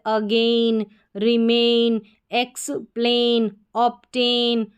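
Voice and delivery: "again, remain, explain, obtain" each split into two parts; syllable by syllable. Shown as a demonstration. Teacher reads a-i-n words to learners, splitting "again, remain, explain, obtain" in two. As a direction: break "explain" into "ex" and "plain". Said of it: "ये spelling को हम तोड़ कर पढ़ रहे हैं".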